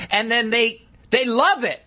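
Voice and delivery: usual speaking voice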